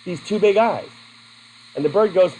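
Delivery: ordinary talk, nothing marked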